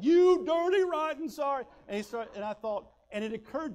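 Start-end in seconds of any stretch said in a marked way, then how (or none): 0.0-1.6 sudden high, very loud bellow; full-force imitation of a sergeant tearing into a recruit; drill sergeant yell. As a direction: explode like an abusive drill sergeant.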